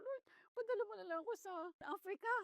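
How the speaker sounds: high, quick mock plea to the Lord; pleading prayer. Preacher retelling her prayer to God, mock pleading. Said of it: "sabi ko".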